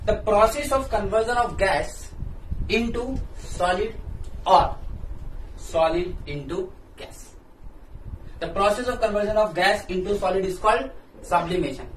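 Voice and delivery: slow, word by word, so students can write it down; slow dictation. Shown as a demonstration. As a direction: dictate each word clearly for notes. Dictating a definition slowly.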